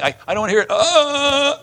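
high whiny cry of refusal; mock yelling crowd. Crowd blocking out Stephen's words, mock yelling. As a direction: ham up a crowd covering its ears. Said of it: "Sorry for the ugly yelling".

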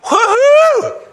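very high, loud whoop; joyful cheer. Acted. Pastor cheers for the faithful servant, loud high whoop. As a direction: celebrate like the crowd at a finish line.

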